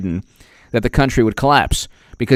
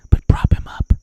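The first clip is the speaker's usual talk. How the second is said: hushed whispered urging; mock urgent whisper. Media propping up the president, mock whisper. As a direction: whisper it like a covert helper.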